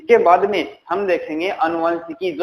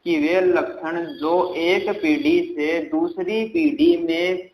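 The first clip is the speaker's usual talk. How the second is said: slow dictation; slower than usual. Shown as a demonstration. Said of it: "क्या बोला मैंने".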